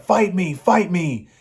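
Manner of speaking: egging his brother on, half-hearted taunt; mock taunt.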